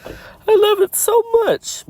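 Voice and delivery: very high, loud, squeaky gush. Acted. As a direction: squeal it slowly in falsetto. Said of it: "my higher voice says".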